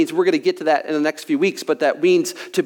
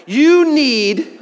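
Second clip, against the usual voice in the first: preacher's shout; 5 semitones higher, 3 dB louder, much slower than usual. Acted. Preacher's climax to congregation.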